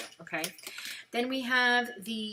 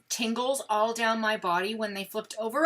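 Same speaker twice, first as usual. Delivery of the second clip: ordinary delivery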